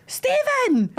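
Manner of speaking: high, hammed-up Scottish shout; imitated Scottish yell; big Scottish accent. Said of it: "in the biggest Scottish accent".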